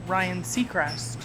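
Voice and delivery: ordinary talk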